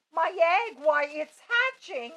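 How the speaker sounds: loud, high shout. A narrator's reading. Horton shouting as his egg starts hatching, loud. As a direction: cry out in alarm. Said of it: "shouted Horton".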